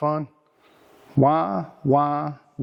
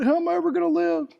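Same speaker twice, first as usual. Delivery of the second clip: a much higher, whiny mock wail; hammed-up wail; 11 semitones higher, faster than usual. Mocking a friend who just lost his job, high hammed-up whine. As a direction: overplay the self-pity like a whining kid.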